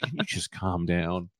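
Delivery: low, soft, slow plea; gently and slowly talk him down; mock soothing. Co-host asks the algorithm to relax, mock soothing.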